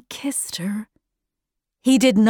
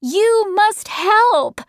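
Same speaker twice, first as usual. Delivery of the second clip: much higher, drawn-out desperate plea; desperate cry; 10 semitones higher, 3 dB louder, much slower than usual. A narrator's reading. Meg begs Mrs Whatsit, desperate high cry. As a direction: plead slowly, voice soaring high. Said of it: "cried Meg".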